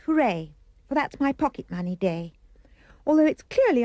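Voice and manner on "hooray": plain reading voice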